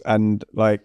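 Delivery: ordinary talk, nothing marked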